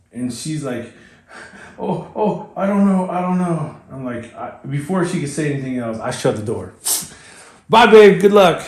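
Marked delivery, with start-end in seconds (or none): 1.8-3.8 high, wavering mock fright; scared. Partner doubting before the race, scared. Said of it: "she's scared". 7.7-8.6 loud, high send-off; called-out farewell. Retold or imitated. Husband shuts door on scared partner, calling good luck.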